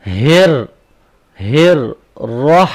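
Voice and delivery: tajweed drill of heavy letters; heavy sound demo. Shown as a demonstration. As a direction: push ہر from the chest. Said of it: "را کو ہم نے موٹا پڑھنا ہے".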